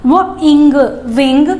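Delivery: "वो, इंग" said apart and then joined as "विंग"; sound blending. Shown as a demonstration. Teacher sounding out wing for kids. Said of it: "तो क्या होगा?".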